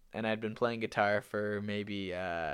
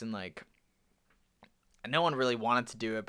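normal talking voice

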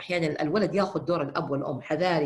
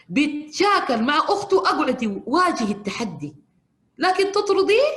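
much higher, louder reproach; scolding the mother; 9 semitones higher, 8 dB louder than usual. Retold or imitated. Counselor raising her voice at the mother, higher and louder. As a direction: drive the blame home hard. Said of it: "قلت لها".

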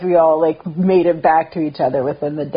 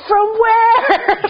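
very high, disbelieving question through laughter; cracking up while mocking the friend; incredulous, laughing; 4 dB louder than usual. Acting friend's angry beer quarrel.

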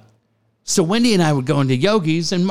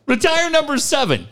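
mock impression; 9 semitones higher than usual. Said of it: "Do the Dick Williams impression".

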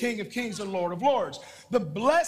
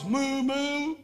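mock moo, higher; preacher imitating a cow for the church; 6 semitones higher than usual. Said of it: "understand a cow and it goes".

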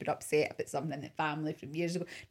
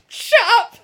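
much higher and louder, through a laugh; laughing shut up; 18 dB louder than usual. Retold or imitated. Jen silencing Pam, a laughing, much higher and louder retort. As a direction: snap it back while laughing. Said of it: "Jen's like".

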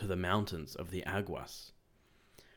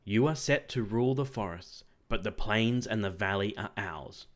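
ordinary delivery

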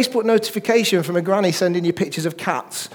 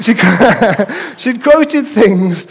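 laughing; 3 semitones higher, 6 dB louder than usual. Real, not acted. Laughs while telling.

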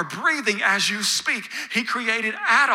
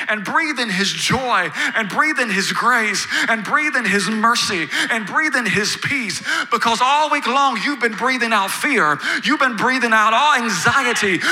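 rising, louder sermon build to a ringing call; preaching at full force; 5 dB louder than usual. Acted. Pastor urging the congregation to rest, preaching loud and rising. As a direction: preach it harder with every breathe.

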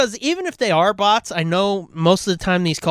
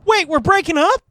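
much higher, louder startled question; surprised echo; 10 semitones higher, 4 dB louder than usual. Real, not acted. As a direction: repeat it back in disbelief. Echoing friend's words.

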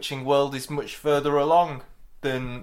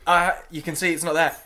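ordinary talk

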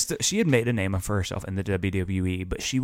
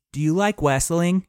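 put-on small, timid kid's voice, high and a bit louder; little kid; 8 semitones higher, 5 dB louder than usual. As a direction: ask shyly like a tiny fan at a panel. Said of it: "the little kid goes".

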